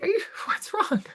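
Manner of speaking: high-pitched mock alarm; acted concern. Acts out exchange with texting colleague.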